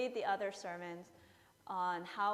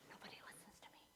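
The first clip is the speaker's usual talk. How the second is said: whispered grumble to himself; self-pitying whisper; 3 semitones lower, 20 dB quieter, much faster than usual. Retold or imitated. Husband sulking over his knife, in a whisper. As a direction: hiss it under your breath, sorry for yourself. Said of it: "He's like".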